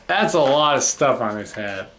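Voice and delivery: usual speaking voice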